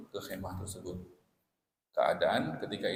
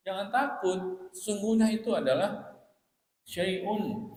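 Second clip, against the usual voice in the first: high, slow, soothing comfort; calm a frightened young woman; gentle raised reassurance; reassuring; 9 semitones higher, slower than usual. Retold or imitated. Prophet reassures Aisyah.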